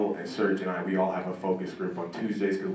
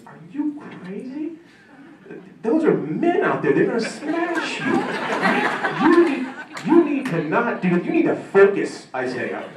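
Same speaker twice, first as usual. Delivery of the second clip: high, loud, strained coach's yell, laughing through it; a coach screaming at an athlete to stick with track; imitated screaming coach; 4 dB louder than usual. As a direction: bellow at the kid like a furious coach. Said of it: "This is what he's screaming at me".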